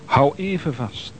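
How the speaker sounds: ordinary delivery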